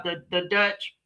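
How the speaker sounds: ordinary talk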